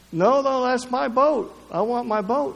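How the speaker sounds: put-on a small boy begging for his boat back; pleading little boy.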